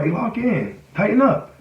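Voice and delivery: quick rallying cue to yourself; self pep talk. Acted. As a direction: psych yourself up in the corner. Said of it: "I get in that corner".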